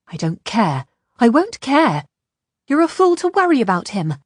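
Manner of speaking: defiant, rising in pitch and force; angry defiance. A narrator's reading. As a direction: shout down her worry. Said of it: "shouted at her angrily".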